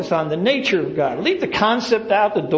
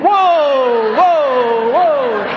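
mock yell; 2 semitones higher, 6 dB louder, much slower than usual. Mock yelling at horse.

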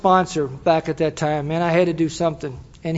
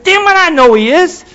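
high, loud comic outburst; exasperated angry shout; 11 semitones higher, 9 dB louder than usual. Retold or imitated. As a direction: explode at the sponsor in mock fury. Acted outburst at sponsor's repeated mantra.

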